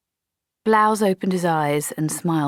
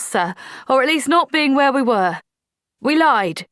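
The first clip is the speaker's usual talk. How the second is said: higher, louder, steady confession; Polly pleading her case, voiced higher and louder; earnest young soldier; 9 semitones higher, 5 dB louder than usual. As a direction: own up bravely, sir by sir.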